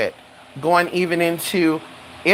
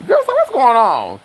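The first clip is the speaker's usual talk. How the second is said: mock astonishment; 7 semitones higher, 3 dB louder than usual. Acts out astonishment at the suitors.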